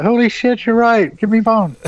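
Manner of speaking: high-pitched mock astonishment; retold amazement. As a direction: act out her astonished outburst. Retold optometrist's amazed discovery.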